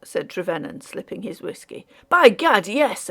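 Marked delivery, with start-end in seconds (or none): 2.1-3.1 a sharp, louder exclamation, pitched up; exclaiming. A narrator's reading. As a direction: burst out with hearty agreement. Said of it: "exclaimed the Major".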